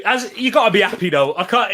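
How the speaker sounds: usual speaking voice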